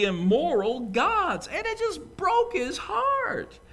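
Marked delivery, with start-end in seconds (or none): 1.5-3.6 high, slow, aching cry on broke; heartbroken lament. Acted. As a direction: let it break with grief, drawn out. Preacher grieving over lost city.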